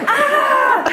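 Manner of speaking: high, loud cry of pain; shrill scream. A narrator's reading. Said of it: "Her scream was shrill".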